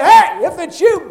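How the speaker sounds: a retold call across the water, slowed. Peter calling to Jesus on the water, loud and slow. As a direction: call out to someone far off.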